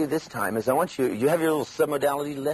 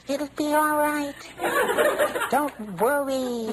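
high, slow, faint-sounding inner voice; a tiny voice from far away at the back of the head; small distant voice; 12 semitones higher, slower than usual. Retold or imitated. Doubtful little inner voice reassuring you, small and distant. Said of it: "the little voice off in the distance".

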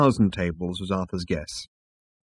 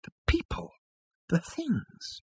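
hushed, airy, almost voiceless; breathy whisper; 11 dB quieter, slower than usual. A narrator's reading. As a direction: breathe the words out in awe. Arthur awed, breathing words.

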